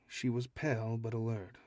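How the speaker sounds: ordinary delivery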